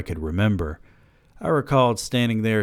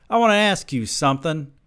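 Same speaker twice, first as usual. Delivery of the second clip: high-pitched calling out; yelled; 9 semitones higher, 4 dB louder than usual. A narrator's reading. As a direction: yell a request after him. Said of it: "I yelled at him".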